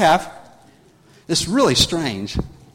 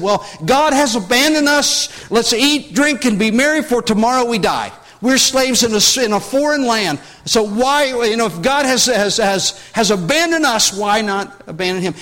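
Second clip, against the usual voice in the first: raised, voicing a people who feel abandoned; despairing exile's voice; 6 semitones higher, 4 dB louder than usual. Exiles in a foreign land complain God abandoned them, raised and despairing. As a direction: speak as slaves who think God left them. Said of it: "you could have easily said".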